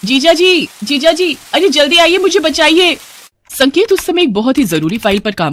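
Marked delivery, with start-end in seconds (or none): 0.1-3.0 high-pitched, urgent cry for help; shouting for help. Acted. Woman in bathroom calls brother-in-law for rescue, shouting. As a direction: call out in alarm to be rescued. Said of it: "उसने जोर जोर से चिल्लाना शुरू किया".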